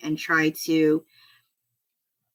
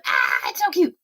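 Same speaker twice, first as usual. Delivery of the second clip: mock squeal over something cute, higher pitched; 8 semitones higher than usual. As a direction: squeal it out, acting out your delight. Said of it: "I do squeal".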